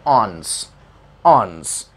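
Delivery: "ऑन्स" as one syllable, onus squeezed to awns; careful model of "ऑन्स", no break; correct pronunciation. Shown as a demonstration. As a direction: say "ऑन्स" as a single falling syllable. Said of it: "इसका सही pronunciation होता है".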